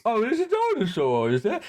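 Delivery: high, put-on dopey surprise; mock clueless voice. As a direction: play him as oblivious.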